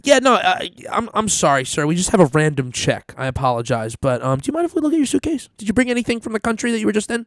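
high, fast, overly polite mock officer; sing-song fake courtesy, words rushed. Customs officer asking to search suitcase, high fake-polite voice. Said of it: "They go".

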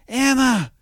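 high, loud call across the tram; mock call to friends, high and loud. Woman calling out to friends.

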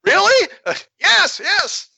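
very high, squeaky, quick; squeaky alien voice. Pretend.

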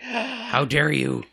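slow, loud indignant dog; offended dog voice. Retold or imitated. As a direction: draw it out like a wronged pet. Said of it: "so he's gonna be like".

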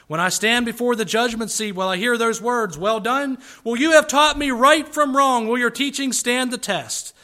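loud, high, urgent preaching cry; impassioned poem climax. Acted. Preacher voices a child questioning his father before judgment, impassioned. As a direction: build to a ringing, forceful challenge.

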